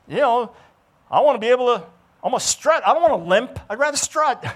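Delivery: high, loud, rousing sermon voice; fervent preaching. Acted. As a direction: preach it hard and lift the room. Preaching at full force.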